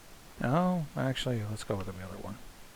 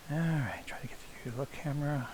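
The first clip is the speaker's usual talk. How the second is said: whispering; 2 semitones higher, 10 dB quieter than usual. Real, not acted. Quietly fiddling with camera.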